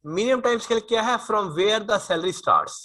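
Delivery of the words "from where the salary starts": very slow, word by word for notes; slow dictation. Shown as a demonstration. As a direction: dictate it slowly so students can write. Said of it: "teacher dictates definition".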